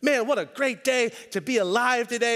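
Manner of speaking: high, loud, hammed-up sunny greeting; bouncy mock enthusiasm; mock cheerful. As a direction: play the oblivious morning optimist. His cheery morning self, mocked.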